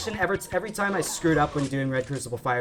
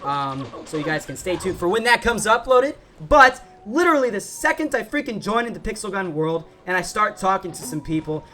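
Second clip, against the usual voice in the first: ordinary talk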